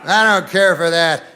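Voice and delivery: loud, indignant huff; mock outrage. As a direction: play the idiot who is outraged. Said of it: "outraged like an idiot".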